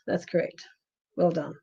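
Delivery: "That's correct" breathed out as a soft whisper; hushed, voiceless "That's correct" as an aside. Real, not acted. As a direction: repeat "That's correct" under your breath. Repeating the confirmation aside.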